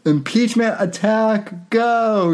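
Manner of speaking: high hammed-up attack shout; anime attack cry. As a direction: yell it like a battle move. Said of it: "she cried".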